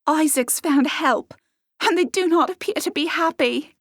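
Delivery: eager young servant girl, lightly voiced.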